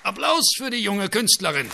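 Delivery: viel höher und lauter ausgerufen; laut ankündigen wie ein Conférencier; Ansage ans Publikum. Acted. Dirigent kündigt dem Publikum an. (Dirigent ruft Applaus für die Flöte aus, viel höher)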